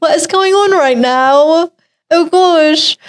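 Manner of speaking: high, slow put-on British accent. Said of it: "that was the best accent".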